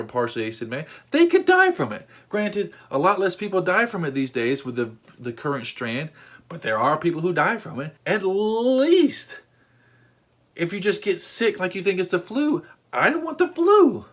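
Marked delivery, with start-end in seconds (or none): 1.1-2.1 much higher and louder on die; emphatic outburst. Real, not acted. Ranting to the camera about Steve, a high loud outburst. 12.9-14.1 indignant outburst. Real, not acted. Rant against getting sick.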